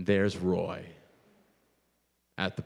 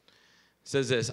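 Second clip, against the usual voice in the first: ordinary talk, nothing marked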